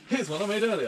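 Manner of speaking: high showy presenter voice. Acted. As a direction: ham up the TV catchphrase. TV presenter catchphrase.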